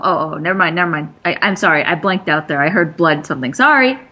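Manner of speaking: ordinary talk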